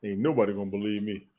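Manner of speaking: usual speaking voice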